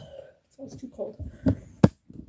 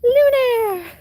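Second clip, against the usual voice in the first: high, loud gasp then calling the dog's name; excited call; 13 semitones higher, 11 dB louder than usual. Real, not acted. Owner calling her dog, startled and loud.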